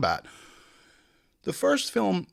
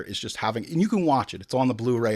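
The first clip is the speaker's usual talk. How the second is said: usual speaking voice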